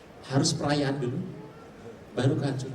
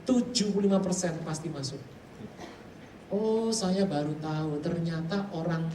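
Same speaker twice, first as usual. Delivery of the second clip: ordinary talk, nothing marked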